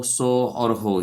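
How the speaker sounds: each sound drawn out slowly for students; slow classroom demonstration of the sounds; slow sound drill. Shown as a demonstration. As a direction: spell out सो and हो slowly, one by one. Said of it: "यह चार व्यंजन होते हैं".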